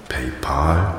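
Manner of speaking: PayPal with an open "pahl" ending, softly; quiet, tentative PayPal ending in "pahl"; trying out pronunciation. Shown as a demonstration. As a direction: try "PayPal" as "pay-pahl", questioning. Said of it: "wie man es richtig ausspricht".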